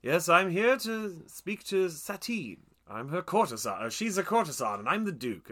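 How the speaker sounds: lightly mock a film villain introducing himself; the Duke. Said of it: "sounds a bit like Q".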